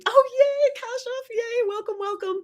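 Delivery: very high squealed welcome; high-pitched, delighted aside; excited greeting. Real, not acted. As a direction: cheer a new arrival in a high squeal. Greeting a newcomer to the live.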